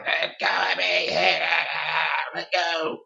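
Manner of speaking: Robert being choked, begging; choking plea. Retold or imitated. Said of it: "He's going".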